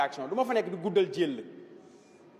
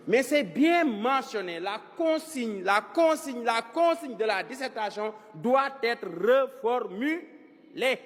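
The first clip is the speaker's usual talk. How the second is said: measured, deliberate teacher's emphasis; slow, emphatic insistence; 2 semitones higher, slower than usual. Real, not acted. Teacher drumming an exam rule into pupils, slow and emphatic. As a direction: spell out the rule slowly, word by word.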